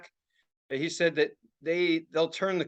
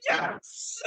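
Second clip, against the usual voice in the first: thrilled hiss; 5 dB quieter than usual. Retold or imitated. Reenacts cheering the explosion.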